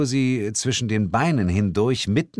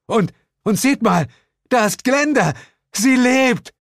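high, loud, elated shout; breaking into excited cries; joyful calling out; 6 dB louder than usual. Acted. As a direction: announce a miracle to everyone.

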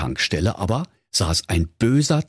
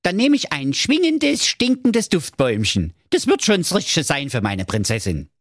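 Marco's voice; 7 semitones higher than usual. Acted. Marco orders.

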